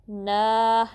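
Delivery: ना drawn out evenly; two-count stretch. Shown as a demonstration. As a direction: hold the long a in ना for two counts. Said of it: "ना को टू count stretch करना है".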